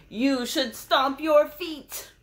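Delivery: high, loud, slow elephant line; booming elephant demand. Acted. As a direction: stomp it out like an elephant. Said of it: "It says".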